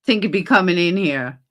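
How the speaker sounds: ordinary talk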